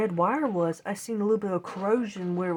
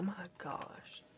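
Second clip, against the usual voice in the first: breathy stunned whisper; hushed disbelief at the price; whispered my gosh; 14 dB quieter than usual. Retold or imitated. Shocked at console prices.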